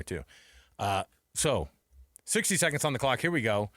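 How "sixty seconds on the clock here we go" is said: ordinary talk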